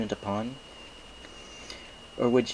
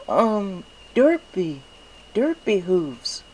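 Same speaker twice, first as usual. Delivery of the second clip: very high, slow, dreamy filly voice; dazed Derpy; 11 semitones higher, 6 dB louder, much slower than usual. Acted. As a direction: say your name slowly as if half-lost. Derpy introduces herself dazedly.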